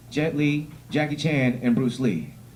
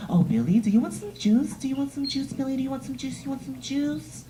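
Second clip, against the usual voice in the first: put-on baby talk; 11 semitones higher than usual. Mother baby-talking her son Billy, high cooing voice. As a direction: coo at the kid like a doting mom. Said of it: "baby talking the shit out of her kid".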